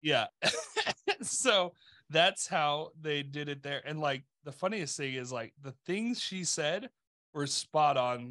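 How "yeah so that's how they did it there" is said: ordinary delivery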